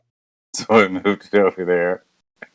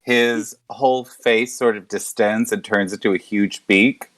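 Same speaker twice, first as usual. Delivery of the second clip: normal talking voice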